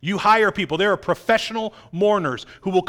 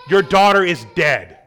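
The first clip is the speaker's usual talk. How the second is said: blunt; 8 dB louder than usual. Acted. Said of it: "Talk about bluntness".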